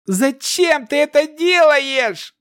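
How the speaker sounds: high, slow anguished shout. A narrator's reading. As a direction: yell it out in rage. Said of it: "продолжал кричать Кирос".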